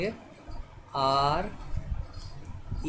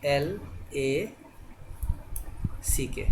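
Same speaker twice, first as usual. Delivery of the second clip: slow spelling; 4 dB quieter, much slower than usual. Shown as a demonstration. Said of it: "यानी".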